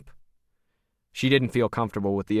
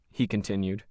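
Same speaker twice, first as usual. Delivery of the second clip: usual speaking voice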